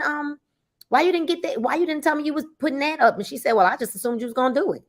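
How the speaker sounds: ordinary talk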